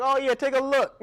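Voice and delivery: high, strained fake-polite voice; nervous imitation. Owner letting a friend see the purse, nervously. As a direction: hand it over, pretending to be relaxed. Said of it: "you be, like, nervous".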